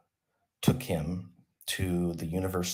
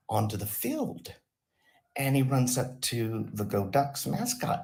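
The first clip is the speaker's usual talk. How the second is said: ordinary talk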